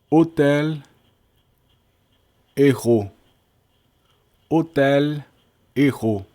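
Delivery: hôtel and errou said the French way, silent h, no h sound; French pronunciation. Shown as a demonstration. Teacher modelling French words for students, silent h. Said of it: "Hôtel é com H muet e errou é com H aspiré".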